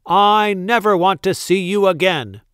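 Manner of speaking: high, loud, angry dismissal; shouting. A narrator's reading. Duke throws baker out of town, shouting. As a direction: bellow it with finality. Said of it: "shouted the Duke".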